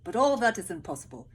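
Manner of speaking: loud, resigned soldier voice; gloomy soldier. Acted. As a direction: dash their hopes firmly. Third soldier voiced in story.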